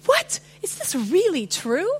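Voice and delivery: a sudden high gasp of wonder on What, then a lighter question; delighted disbelief at unexpected good news; amazed disbelief. Retold or imitated. Believer marvelling at grace, amazed and delighted.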